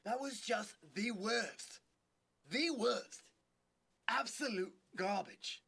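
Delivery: slow, lightly voiced judge put-down; Simon Cowell impression. Playing a talent-show judge, lightly voiced Simon Cowell. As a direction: drawl a light mock Simon Cowell verdict. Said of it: "Simon Cowell sounds like".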